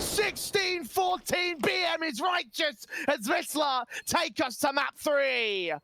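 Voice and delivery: climbing high-pitched play-by-play call; esports caster. Real, not acted. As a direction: build the hype to the final kill. Live play-by-play of clutch.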